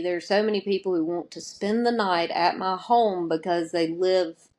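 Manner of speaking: usual speaking voice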